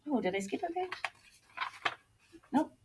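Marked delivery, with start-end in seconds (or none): none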